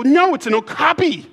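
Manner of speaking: put-on high, loud child's correction; mock outrage in a high voice; indignant young niece. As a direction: imitate a little kid scolding a clueless grown-up. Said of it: "And she'd go".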